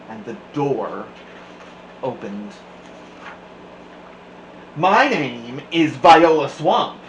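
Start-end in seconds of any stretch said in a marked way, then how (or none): 4.8-7.0 slow, louder stern witch; scratchy witch voice. A narrator's reading. A hint of a stern substitute teacher. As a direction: announce yourself like a menacing teacher. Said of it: "said the lady in a scratchy voice".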